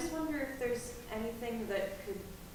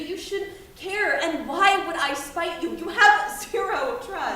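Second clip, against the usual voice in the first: loud, higher, slowing furious rant; angry rant; 4 semitones higher, 8 dB louder than usual. Acted. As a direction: hammer each point at her. Teen rants at mother.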